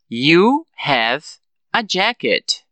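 broken in two, pause after "have", "jacket" falling; affirmative statement. Shown as a demonstration. Teacher repeats the affirmative for learners, split falling statement. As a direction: separate "You have" from "a jacket", ending down. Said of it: "Na afirmativa, eu digo".

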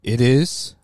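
deep dramatic ring announcer; lucha libre announcer. Acted. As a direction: boom out the title, drawn low. Segment title announced dramatically.

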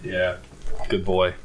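put-on deep, condescending good boy; low patronising praise. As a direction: talk down like praising a dog.

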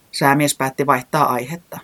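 usual speaking voice

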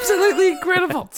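high, fast, cracking up on the words; laughing. Real, not acted. Brother boasting of fooling mum, laughing. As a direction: squeal it out through laughter.

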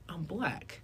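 mock-awed whisper; hushed realization. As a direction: whisper it as a dawning discovery. Acting out a dawning realization.

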